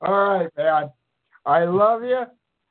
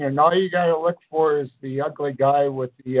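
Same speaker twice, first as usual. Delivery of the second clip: ordinary talk, nothing marked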